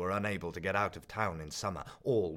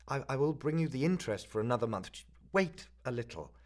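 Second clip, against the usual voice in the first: higher, louder, faster nervous plea; a flustered young man begging for time; student pleading with the pawnbroker, a lightly voiced hurried stammer; 6 semitones higher, 4 dB louder than usual.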